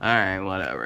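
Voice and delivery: low, unbothered mumble of a man; chilled-out husband. Retold or imitated. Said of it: "he just chilling".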